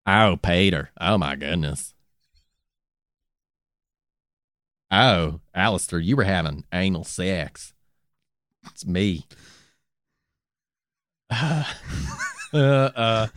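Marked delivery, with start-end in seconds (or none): 0.1-9.2 Cleveland impression. Host voicing Cleveland as a god, much lower. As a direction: murmur it like mild Cleveland. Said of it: "I'm losing Cleveland".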